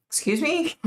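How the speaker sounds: high, rising disbelief; taken aback. Retold or imitated.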